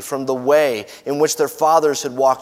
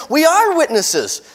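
much higher, louder crowd reply; pitched-up, ringing declaration; emphatic affirmation; 13 semitones higher, 5 dB louder than usual. Acted. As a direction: affirm it eagerly as the people. People affirm their vow.

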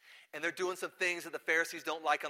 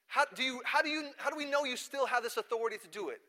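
higher-pitched insistent question; challenging; 8 semitones higher, 4 dB louder than usual. Retold or imitated. Doubters question Jesus' authority, challenging. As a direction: press the point, higher and incredulous.

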